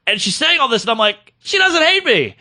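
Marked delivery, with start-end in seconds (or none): 1.4-2.4 high-pitched joyful relief; elated realization. Retold or imitated. Comic realizing Schumer likes him, elated. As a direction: let relief burst out, pitched way up.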